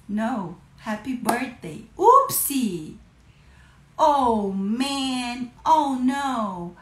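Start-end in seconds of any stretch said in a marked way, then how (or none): 2.0-3.0 playful oopsie. Shown as a demonstration. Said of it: "playful oops". 4.0-6.8 mock dismay. Shown as a demonstration. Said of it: "mock dismay".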